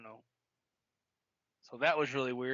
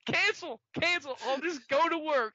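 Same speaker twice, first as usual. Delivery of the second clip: much higher, exasperated giving up; 10 semitones higher, 4 dB louder than usual. Retold or imitated. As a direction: throw up your hands at the phone. Exasperated retelling, high pitch.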